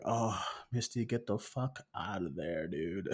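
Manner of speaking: softly urging her to flee; hushed warning. Retold or imitated. Viewer quietly urging Misty to flee, hushed. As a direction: breathe it low like yelling at a screen.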